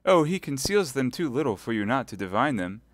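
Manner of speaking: high, pleading martyr; wounded innocence. Acted. Milady pleading her innocence to Felton. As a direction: protest innocence with a smile.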